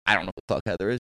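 under the breath, not wanting to be heard; muttered aside. Retold or imitated. To the neighbor, a low muttered aside about Heather.

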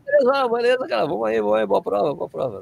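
high, bright cheer fading away; cheerful greeting. Retold or imitated. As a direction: greet passing fans happily. Himself replying to fans while filming.

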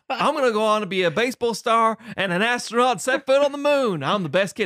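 high, excited mock child voice; hammed-up childish boasting; boastful kid. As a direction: brag like a starry-eyed kid. Acts boastful doomed kid.

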